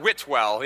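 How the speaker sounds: "Whitwell" slowly, as two full words, WIT-WELL; northern pronunciation. Shown as a demonstration. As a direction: spell out both syllables of "Whitwell": wit, well. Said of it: "up in the north".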